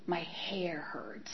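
slow, hushed, aching whisper; slow whisper, head pounding; whispered misery. Retold or imitated. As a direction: barely get it out, hungover. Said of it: "and I'm like".

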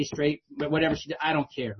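ordinary talk

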